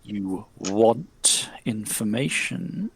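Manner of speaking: slow, low, soft otherworldly murmur; honeyed spirit voice. Acted. Spirit questioning the player, slow honeyed murmur. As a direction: drip each word out like honey. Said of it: "the spirit goes".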